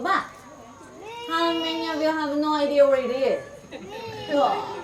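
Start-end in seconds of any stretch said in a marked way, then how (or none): none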